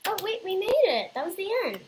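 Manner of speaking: high, loud victory cheer; excited shout. Real, not acted. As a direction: yell it out, thrilled. Finishing the tower, cheering.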